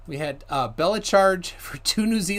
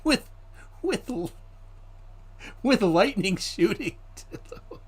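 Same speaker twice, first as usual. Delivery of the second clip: laughing; 6 semitones higher than usual. Real, not acted. Laughing while repeating.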